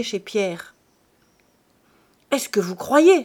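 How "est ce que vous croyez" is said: louder, higher rude cry; insolent outburst. Acted. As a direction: fling it at her with contempt. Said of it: "s'écria-t-il insolemment".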